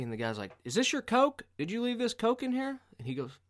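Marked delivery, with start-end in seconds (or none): 0.6-2.8 high-pitched mock interrogation; accusing question. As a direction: demand an answer in a raised, high voice. Acts out man questioning a stranger.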